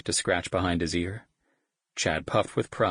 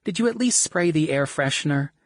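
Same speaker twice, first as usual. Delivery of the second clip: much higher, louder whiny plea; whining; 10 semitones higher, 5 dB louder than usual. Acted. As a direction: complain with a nose-wrinkling whine. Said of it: "she whined".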